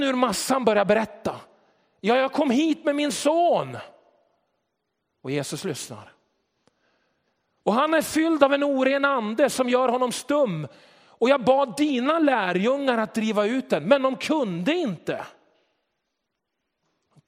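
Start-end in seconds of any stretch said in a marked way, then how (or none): 2.0-3.8 raised, urgent father's voice; a father begging for his son; desperate father. Retold or imitated. Father tells Jesus of his son, raised and urgent. 7.6-15.3 higher, louder pleading father, slowing at the end; a father at the end of his rope; desperate father. Retold or imitated. Father explains to Jesus the disciples failed, raised and pleading.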